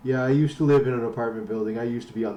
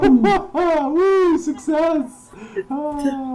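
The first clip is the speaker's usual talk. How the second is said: excited; 13 semitones higher, much slower than usual. Real, not acted. Celebrating three viewers.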